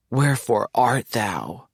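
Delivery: slow, theatrical stage line; mock Shakespearean. [name] playfully quoting Shakespeare to his friend, slowly theatrical. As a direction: recite it like a lovestruck Romeo. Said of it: "If only we still talked like that".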